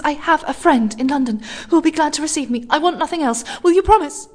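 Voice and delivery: high, fast, frantic pleading; rapid anxious pleading. A narrator's reading. Lone woman begging a stranger, high and frantic. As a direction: rush it, close to panic. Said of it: "more and more rapidly".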